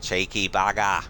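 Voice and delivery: a quick Aussie "Cheeky bugger," said slowly with a broad Australian twang; light mock Aussie accent. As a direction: drop lightly into an Aussie bloke. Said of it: "sounds very stereotypically Australian".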